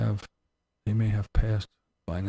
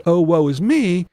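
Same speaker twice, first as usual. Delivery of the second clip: louder mock lament; mock woe; 6 semitones higher, 8 dB louder than usual. Mocking hypocrites who play the victim, mock lament. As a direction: ham up the poor-me sigh. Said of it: "convenient for you to say".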